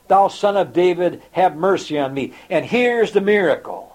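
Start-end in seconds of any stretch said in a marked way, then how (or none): none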